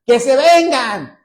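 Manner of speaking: high, loud, triumphant cry; excited shout. Retold or imitated. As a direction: shout it like a man who just saw the angels. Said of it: "gritando y diciendo".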